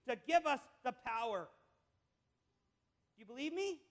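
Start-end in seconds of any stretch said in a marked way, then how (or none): none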